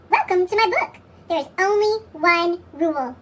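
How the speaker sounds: plain reading voice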